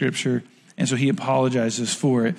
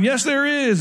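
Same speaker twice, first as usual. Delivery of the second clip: a light, higher cheery elf chirp; Buddy the Elf; 9 semitones higher, slower than usual. Retold or imitated. Pharisees yelling back at the Sadducees, lightly voiced cheery elf. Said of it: "sounded like Buddy the Elf".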